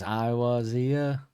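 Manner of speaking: drawn-out Northern vowels, slowly stretched; full Northern English accent. Retold or imitated. Co-host imitating how the singer delivers a favourite lyric, full Northern accent. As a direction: imitate a broad Northern singer, dragging the words out. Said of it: "They went full Northern for that one".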